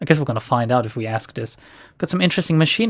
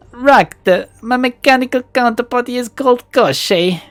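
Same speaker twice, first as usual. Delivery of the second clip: higher, louder put-on Russian accent; Russian-accented shopkeeper introducing his drone; cultured Russian accent; 9 semitones higher, 4 dB louder than usual. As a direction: introduce your drone proudly, Russian-style. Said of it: "There's a hint of a Russian accent".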